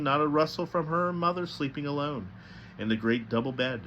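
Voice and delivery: plain reading voice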